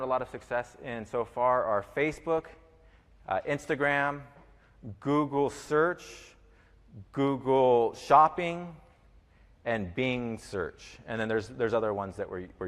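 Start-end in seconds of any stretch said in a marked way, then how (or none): 1.9-10.8 each platform named slowly with pauses; slow, spaced-out list of names. Real, not acted. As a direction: spell out the list slowly so they can note it. Listing platforms to note down.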